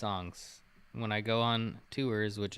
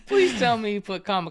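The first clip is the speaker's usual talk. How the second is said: laughing disbelief; 11 semitones higher, 10 dB louder than usual. Real, not acted.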